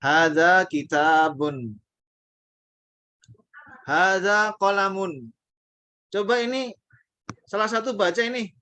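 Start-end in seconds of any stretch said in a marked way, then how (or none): 0.0-5.3 slow reading, long ta in kitabun, short la in qalamun; long and short vowels. Shown as a demonstration. Teacher drills vowel length, slow and loud. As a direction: read both slowly, minding long and short. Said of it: "mesti panjang pendek".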